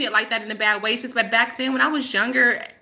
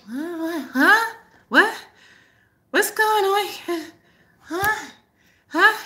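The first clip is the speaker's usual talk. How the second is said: high, dazed mock confusion; Kathy; 9 semitones higher, slower than usual. Student too zoned to follow her teacher, mock dazed. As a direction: imitate someone zoned out, high and slow to catch on. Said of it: "She was, like, zoned".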